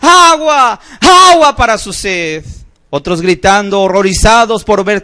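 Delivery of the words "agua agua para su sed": high, loud pleading shout; desperate cry for water. Retold or imitated. Preacher voices the lost begging for water, loud high shout. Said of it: "Otros pidiendo agua".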